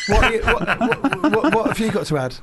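high, loud, laughing prompt; playful demand. Real, not acted. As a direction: tease him into talking. Teasing cue to Mark.

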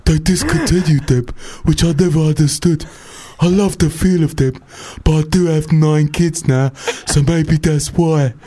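steady hammed-up emailer voice, slowing at the end; Roy. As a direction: read the email as an odd little man. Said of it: "I'll just do it in Roy's voice".

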